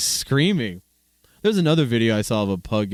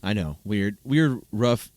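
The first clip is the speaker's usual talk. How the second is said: ordinary delivery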